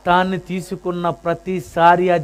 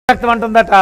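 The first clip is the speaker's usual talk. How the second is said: ordinary delivery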